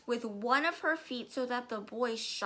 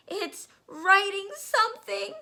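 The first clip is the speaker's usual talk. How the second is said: much higher, loud panicked cry; shrill scream; 11 semitones higher, 8 dB louder than usual. Acted. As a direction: shriek it in terror. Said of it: "screamed Nigel".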